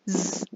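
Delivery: Z đọc /z/ rung, trầm hơn; ending z sound. Shown as a demonstration. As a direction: buzz a low z. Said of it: "Thứ hai mình sẽ đọc là". (âm z hữu thanh)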